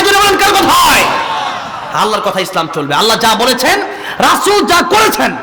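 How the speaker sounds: usual speaking voice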